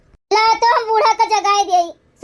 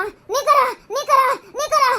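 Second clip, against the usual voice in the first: strangled gasp; 2 semitones higher than usual. Acted. Gasping as life leaves.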